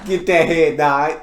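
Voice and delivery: ordinary delivery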